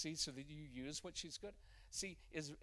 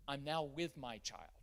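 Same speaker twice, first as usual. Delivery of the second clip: ordinary delivery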